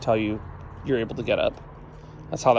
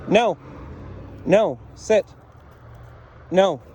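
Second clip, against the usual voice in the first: higher-pitched one-word dog commands; separate, insistent orders to a dog; firm correction; 6 semitones higher, slower than usual. Real, not acted. Trainer corrects Frida for breaking the sit, firm correction.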